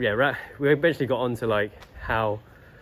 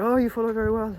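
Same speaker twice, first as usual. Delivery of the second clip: high, odd-sounding praising voice; old man imitation; 12 semitones higher than usual. Tai chi teacher praising his follower, high imitated voice. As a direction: praise him warmly in the stranger's high voice.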